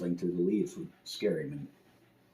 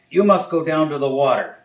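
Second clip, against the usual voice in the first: louder, higher solemn owl voice; wise owl; 7 semitones higher, 10 dB louder than usual. Acted. Wise owl advising the frightened bear, solemn and louder. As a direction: pronounce it like a sage. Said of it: "he says".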